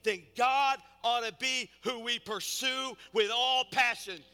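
high, loud, punched pulpit delivery; full-force preaching. Real, not acted. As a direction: drive each point home to the congregation.

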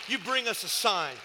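high-pitched, forceful pulpit proclamation; fervent preaching. Real, not acted. Preacher telling the church of signs and wonders, fervent. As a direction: proclaim it in driving, punched phrases.

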